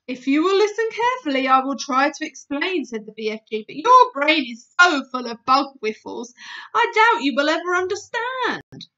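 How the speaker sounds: loud, fast condescending giant; lecturing giant. Acted. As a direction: lecture her, a bit superior. BFG lectures Sophie.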